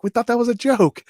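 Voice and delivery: high, loud, amazed disbelief; a jump of surprised pitch. Retold or imitated. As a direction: blurt it out in shock. Soldiers hearing about real wine.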